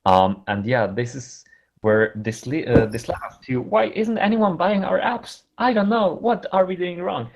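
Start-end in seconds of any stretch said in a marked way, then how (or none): 3.6-7.3 puzzled voice. Retold or imitated. Quoting puzzled app makers.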